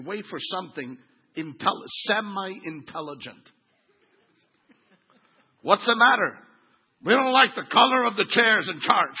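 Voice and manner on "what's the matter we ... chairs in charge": loud, higher voice of an upset churchgoer; hammed-up gripe, each phrase punched out loudly; mock indignant complaint. Upset members complain at the pastor's door, mock indignant. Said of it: "they come knocking at Pastor Ralph's door".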